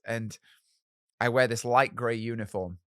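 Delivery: ordinary talk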